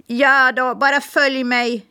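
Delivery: high, quick parrot voice; chatty parrot. Acted. Parrot introducing herself to Smirke, high bird voice. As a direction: rattle it off like a talkative bird. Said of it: "sa Polly".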